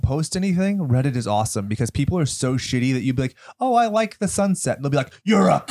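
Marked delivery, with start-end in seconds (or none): 3.6-4.8 tag innocent poster. Retold or imitated. Voicing innocent Reddit poster. 5.2-5.6 higher, sudden mock yell; mock abuse. Host voices Reddit replies to a harmless post, mock abuse.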